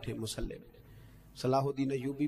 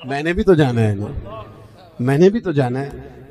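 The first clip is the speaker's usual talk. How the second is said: raised, insistent voice; loud retort; 11 dB louder than usual. Retold or imitated. Farmer insisting to the Shah that he must pass too, loudly. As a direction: push back loudly, unwilling to step aside.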